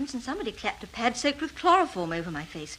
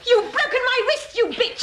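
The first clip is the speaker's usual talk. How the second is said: high, strained cry of pain; pained and angry; 11 semitones higher, 3 dB louder than usual. Acted. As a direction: spit it out through the pain. Wrist twisted in a struggle.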